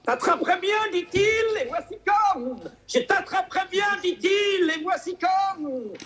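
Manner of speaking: high voice, rising to a shout at the end; high excited bear voice. Retold or imitated. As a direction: squeak it out like an eager bear.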